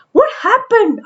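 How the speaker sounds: high, startled what happened; baffled worker's high question; surprised question. A narrator's reading. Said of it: "asked another man".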